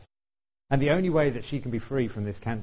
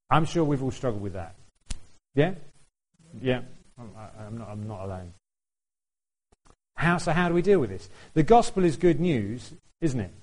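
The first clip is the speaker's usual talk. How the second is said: ordinary talk, nothing marked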